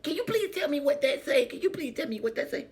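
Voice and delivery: high, eager asking voice; pleading stranger. Retold or imitated. Winner asking a shopper to read, high pleading. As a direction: beg them to read it for you. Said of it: "They be like".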